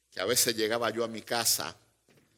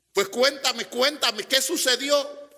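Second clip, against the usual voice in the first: higher, pleading cuéntame; worried husband; 12 semitones higher, 5 dB louder than usual. Retold or imitated. Husband begs his crying wife to tell him, worried. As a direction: coax her gently to speak. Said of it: "yo iba preocupado".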